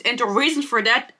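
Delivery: ordinary talk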